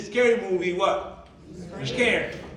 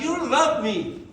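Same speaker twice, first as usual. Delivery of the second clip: mock pleading; 5 semitones higher, slower than usual. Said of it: "I'm like".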